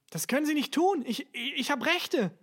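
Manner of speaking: high, loud, stammering protest; desperate cry. A narrator's reading. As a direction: cry out in panic as you are arrested. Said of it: "rief er verzweifelt". (verzweifelt gerufen)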